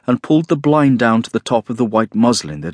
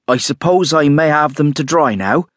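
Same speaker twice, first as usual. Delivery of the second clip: firm, higher-pitched insistence; brooking no denial; 5 semitones higher than usual. A narrator's reading. Landlady offering to dry guest's wet things, brooking no denial. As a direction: insist so that no refusal is possible. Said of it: "in a voice that brooked no denial".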